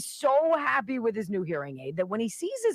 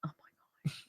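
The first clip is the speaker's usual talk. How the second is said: whispered groan; 14 dB quieter, much faster than usual. Real, not acted. Reaction to bad joke.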